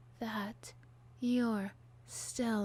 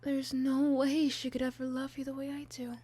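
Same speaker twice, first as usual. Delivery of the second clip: high, intense, possessive pleading; unhinged villain; 4 semitones higher than usual. Acted. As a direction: obsessive lover insisting.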